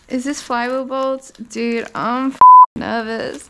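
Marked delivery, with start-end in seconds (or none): 2.0-3.5 louder, jittery blurt; nervous outburst. Real, not acted. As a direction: blurt out the nerves. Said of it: "I'm nervous".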